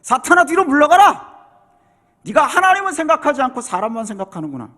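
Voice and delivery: loud, high commanding rebuke; angry rebuke. Acted. Jesus angrily rebuking Peter, loud commanding shout. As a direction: drive him back with a furious order. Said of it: "예수님도 화가 나가지고".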